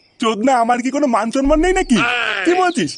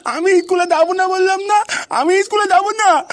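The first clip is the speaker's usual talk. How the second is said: put-on crying child; 6 semitones higher than usual. Child refusing school, crying.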